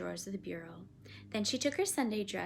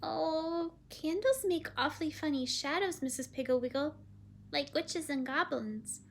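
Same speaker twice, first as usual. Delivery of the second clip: put-on higher, small child's voice; uneasy little girl; 6 semitones higher than usual. Child uneasy about candle shadows.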